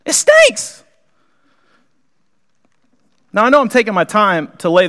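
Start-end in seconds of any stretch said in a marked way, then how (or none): 0.0-0.8 it stinks. Acted. Pastor mimes smelly foot.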